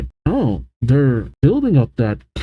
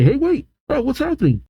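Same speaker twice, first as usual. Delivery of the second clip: alarmed; 13 semitones higher, faster than usual. Acted. Crew as the ship goes vertical, high mock alarm. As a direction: play mild panic at a high pitch.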